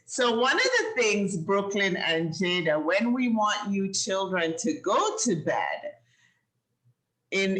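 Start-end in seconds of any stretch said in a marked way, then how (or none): none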